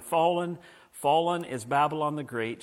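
normal talking voice

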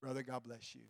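ordinary talk, nothing marked

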